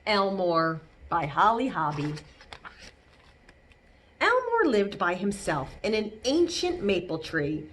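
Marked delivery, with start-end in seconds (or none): none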